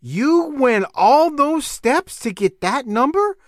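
put-on a parent stunned by a child's long method; disbelief. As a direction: stretch it out like you can't believe the long way round. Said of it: "And I'm like".

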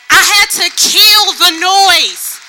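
slow, loud, high proclamation of kill the noise; preaching shout. Real, not acted. Preacher declaring her sermon's title to the church, roaring slowly. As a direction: preach it full force, dragging each word.

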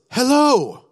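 imitating the stranger's sudden hello; cheery greeting. The older man greets him out of nowhere, in a high, loud, cheery voice. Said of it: "he says".

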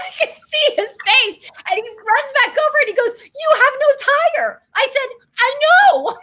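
high laughing retelling; laughing while telling. Real, not acted. Host laughing as she retells the roadside scene, high and bubbly.

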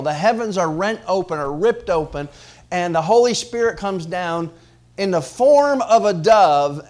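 loud, high preacher's climb; preaching at full force. Acted. Preacher to congregation, rising to full force. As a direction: lift the room as the Spirit descends.

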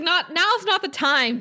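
high, exasperated mock scold. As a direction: tell her off like she's embarrassing you. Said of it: "I was like".

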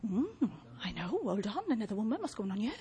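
a hint of a tired fugitive eyeing the tent; wary Sisera. Sisera voiced finding Jael's tent.

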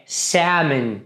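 "salmon" said sæmen with no L; silent L. Shown as a demonstration. Teacher models for students "salmon" with silent L. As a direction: leave the L out of "salmon", slowly. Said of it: "a gente não vai falar o L".

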